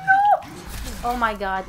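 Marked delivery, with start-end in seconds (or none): 0.1-0.5 high, loud panicked shriek; panicking gamer. Real, not acted. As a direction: yelp as the monster strikes. Partner caught by monster.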